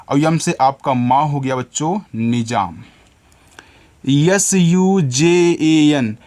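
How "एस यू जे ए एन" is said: slow, letter by letter; spelling letters out. Shown as a demonstration. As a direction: spell each letter slowly for the children. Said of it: "teacher spells letters for children".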